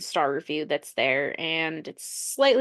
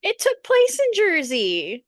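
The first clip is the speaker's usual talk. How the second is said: incredulous; 8 semitones higher, 4 dB louder, slower than usual. Real, not acted. Insisting in disbelief.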